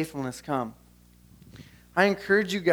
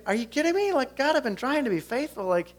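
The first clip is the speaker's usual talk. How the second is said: high, pleading disbelief at God; a fed-up man asking heaven for a break; exasperated complaint; 8 semitones higher than usual. Retold or imitated. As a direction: throw up your hands, half-laughing frustration. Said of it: "he's probably sitting there thinking".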